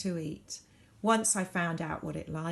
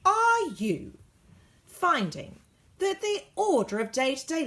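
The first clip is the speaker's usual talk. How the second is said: loud, high announcer pitch; ad read; 6 semitones higher, 5 dB louder than usual. A narrator's reading. Narrator reading the newspaper advert in an ad voice. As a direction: sell it like a radio ad.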